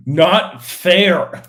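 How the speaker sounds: loud, high cry of protest; mock outraged shout. Mock protest about gifted sister.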